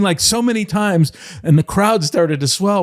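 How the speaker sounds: ordinary delivery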